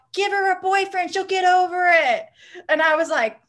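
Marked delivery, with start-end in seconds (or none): 0.1-2.3 mock shout. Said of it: "someone shouts".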